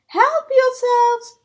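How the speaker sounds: high, slow, ringing invitation; hearty cry. Acted. Mole welcoming the woodland animals, hearty loud call. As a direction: call out generously to all the creatures. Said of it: "cried Mole".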